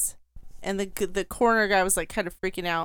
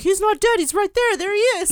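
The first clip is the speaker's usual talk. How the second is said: excited reporters; 10 semitones higher, 4 dB louder, faster than usual. Retold or imitated. Press people shouting in retold scene.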